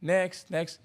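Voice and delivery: impatient cashier waving the queue on; dismissive next. Retold or imitated. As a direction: call the next customer while looking straight past him. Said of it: "like this, looking over him".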